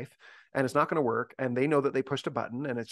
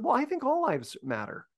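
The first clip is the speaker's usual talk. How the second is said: "Well, I think all lives matter" much higher, light and guileless; innocent, naive friend; 12 semitones higher than usual. Retold or imitated. Well-meaning friend innocently voicing an opinion, pitched high. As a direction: say it wide-eyed, meaning no harm. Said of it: "just an innocent, good heart, not super informed".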